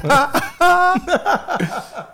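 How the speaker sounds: long high laugh. Real, not acted. Host jokes about his own nickname, laughing high. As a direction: crack up at the joke. Joking about being big-headed.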